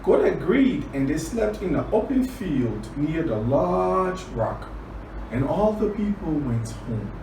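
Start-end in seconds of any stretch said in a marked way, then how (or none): none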